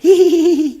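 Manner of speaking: high, loud mocking cackle. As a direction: cackle it out like her. Mocking her after the quote.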